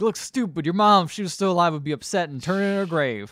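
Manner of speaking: lightly acted parental telling-off; upset dad. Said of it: "very upset about it".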